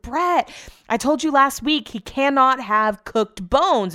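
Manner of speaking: slow, high, exasperated reminder; exasperated scolding. Retold or imitated. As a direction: drag it out like you've said it a hundred times. Retelling scolding her husband.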